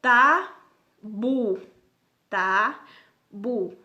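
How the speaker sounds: "Tabu" split slowly as ta, pause, bu; separated syllables. Shown as a demonstration. Teacher separating an example for pupils, "tabu" as two held syllables. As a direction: loudly call out "tabu" one syllable at a time. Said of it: "Quando eu separo, o bu".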